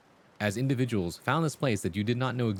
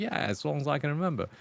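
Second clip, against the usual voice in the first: higher-pitched mock Australian accent; 6 semitones higher than usual. Schoolmate insists the accent is his own, put-on Australian. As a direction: imitate someone faking an Australian accent. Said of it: "Have you always had that accent?".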